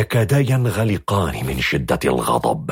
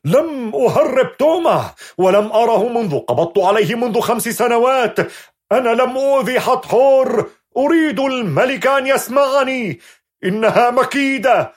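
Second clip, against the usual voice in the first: wounded lion roar. Acted. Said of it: "ويعود لصراخه كالأسد الجريح".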